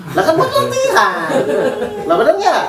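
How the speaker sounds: laughing. Real, not acted. Preacher delighting the congregation, laughing high and loud. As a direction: laugh as you reveal the punchline. Laughing at his own point.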